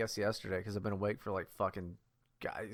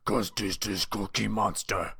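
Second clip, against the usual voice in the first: Cookie Monster voice. Pretend. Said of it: "you gotta just do Cookie Monster".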